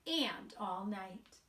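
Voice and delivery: slow, sing-song storybook reading; gentle storybook narration with light character voices; reading to children. A narrator's reading. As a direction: read it aloud to a group of little kids. Reads picture book to children.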